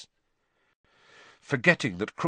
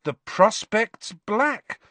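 slow recital; 9 semitones higher, much slower than usual. Acted. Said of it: "Harry recited".

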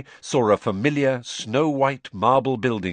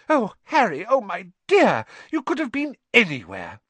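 frantic relief; 12 semitones higher, 4 dB louder than usual. A narrator's reading. Frantic mother finds lost boy.

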